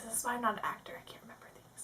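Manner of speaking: hushed, unvoiced self-reproach; barely audible mutter; whispered aside. Real, not acted. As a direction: whisper it to yourself. Muttered aside after botched reading.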